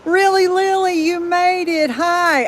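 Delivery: high, loud, excited welcome; real delighted greeting. Real, not acted. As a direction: greet a friend who finally showed up. Greeting a viewer who just arrived.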